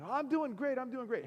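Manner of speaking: high-pitched, bubbly enthusiasm; exuberant reply. Retold or imitated. Regular customer answering deli worker, exuberant. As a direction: pipe up with over-eager cheer.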